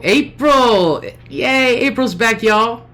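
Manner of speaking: high, loud cheer for April's return; excited welcome. Real, not acted. Cheering a returning viewer back to the chat, excited.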